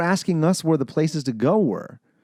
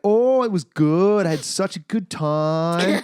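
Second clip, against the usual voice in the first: high, loud, drawn-out Canadian parody; 7 semitones higher, 4 dB louder than usual. Retold or imitated. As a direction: exaggerate every Canadian vowel, pitched up. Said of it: "that stereotypical Canadian accent".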